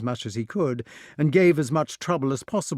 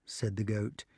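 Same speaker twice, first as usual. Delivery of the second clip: plain reading voice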